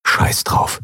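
whispered curse to oneself; toneless, under the breath; inner thought. A narrator's reading. Mate giving up on dignity in his head, whispered thought. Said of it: "dachte er".

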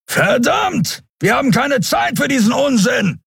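high, exasperated shout; angry call. A narrator's reading. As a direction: shout it out, cursing the delay. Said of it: "rief Kero".

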